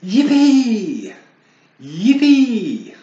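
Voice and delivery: high, loud, squealing joy; excited cheer. Acted. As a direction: cheer like a thrilled little bear. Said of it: "Bernard was very excited".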